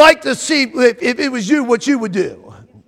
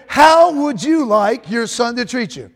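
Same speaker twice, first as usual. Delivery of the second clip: slow, drawn-out HOW WOULD YOU LIKE; deliberate repeat. Shown as a demonstration. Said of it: "that's not what I said. I said".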